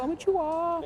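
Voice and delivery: squeaky high konnichiwa; mock Japanese greeting. Echoing a mate's greeting in a squeaky mock voice. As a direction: pipe up a silly konnichiwa. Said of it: "I did the konnichiwa".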